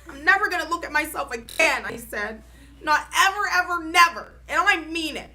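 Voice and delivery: loud, high, insistent; stubborn vow. A narrator's reading. Girl refusing to look in the mirror, loud stubborn vow. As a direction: stamp it out word by word.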